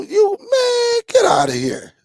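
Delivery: high-pitched squeal of disbelief; mock disbelief. Real, not acted. Streamer waves off a chatter's joke, squealed mock disbelief.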